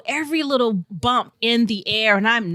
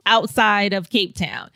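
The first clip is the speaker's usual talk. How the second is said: ordinary delivery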